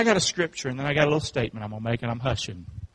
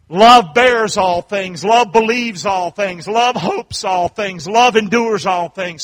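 slow, loud, measured preaching; ringing, drawn-out pulpit recitation; emphatic scripture recitation; 4 semitones higher, 8 dB louder, much slower than usual. Real, not acted. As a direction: proclaim each phrase slowly and forcefully.

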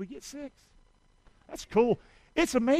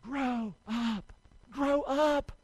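1 soft call; 3 semitones higher, 6 dB quieter, much slower than usual. Shown as a demonstration. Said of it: "say it with me".

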